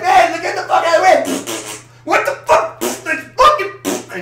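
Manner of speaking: high shouted outburst at the drivers; mock angry yell. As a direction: yell it high and loud, then fizzle out. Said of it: "I'm like".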